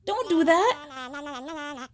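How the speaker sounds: ordinary delivery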